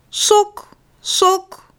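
high, evenly repeated calls; calling like for chickens. A narrator's reading. Mama searches the shop for the sock, calling high. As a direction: call it out as if summoning a hen. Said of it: "net als ze een kip roept".

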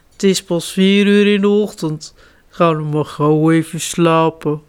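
grumbling papa bear. Acted. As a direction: grumble him back to bed. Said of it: "hij bromt". (papa beer bromt slaperig)

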